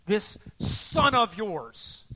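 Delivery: loud, drawn-out, scornful accusation; vehement contempt. Acted. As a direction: spit out each word slowly in anger. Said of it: "vehement language coming out of him".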